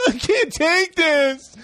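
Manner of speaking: high, slow whiny exit line; fed up, leaving in a huff; guy storming out of the focus group, whiny; mock storming off. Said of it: "one guy storms off".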